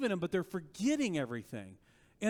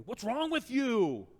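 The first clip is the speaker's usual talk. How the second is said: louder, slowed-down rebuke, stress on with; frustrated teacher scolding his students; exasperated rebuke; 5 semitones higher, 3 dB louder, slower than usual. Retold or imitated. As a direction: press "What's wrong with you?" hard, leaning on "with". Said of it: "Okay, put in another way".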